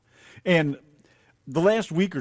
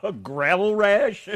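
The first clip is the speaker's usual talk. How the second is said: slow, loud disbelieving echo; stretched-out amused disbelief; incredulous; 7 semitones higher, 8 dB louder, much slower than usual. Real, not acted. Incredulous repeat of odd phrase.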